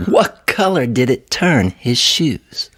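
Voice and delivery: plain reading voice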